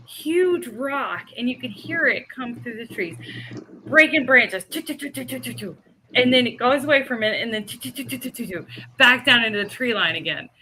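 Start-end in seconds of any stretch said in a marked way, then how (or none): 4.7-5.8 rapid, soft, higher-pitched "choo" noises; imitated crashing rock. Storyteller imitating the rock coming through the trees, quick soft choo sounds. 7.7-8.7 quick string of soft "choo" noises; imitated crashing rock. Storyteller imitating the rock dropping into the tree line, soft rapid choo sounds.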